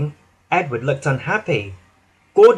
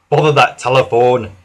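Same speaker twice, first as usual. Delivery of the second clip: frowning grumble; 6 dB louder than usual. Acted. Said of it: "said the Fat Controller, frowning".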